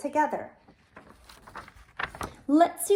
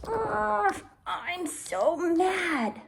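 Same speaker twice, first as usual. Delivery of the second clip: slow, grumbling mock fury; a hammed-up growl of frustration; mock angry groan; 3 semitones higher, much slower than usual. Shown as a demonstration. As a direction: act out being mad for the kids. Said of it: "recognize that you're angry".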